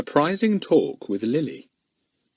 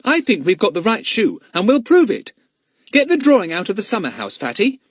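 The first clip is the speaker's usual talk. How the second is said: high, brisk challenge; high-pitched child giving orders; determined schoolboy; 11 semitones higher, 5 dB louder than usual. Acted. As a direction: set out to prove it, bossy and eager. Plays Pip determined.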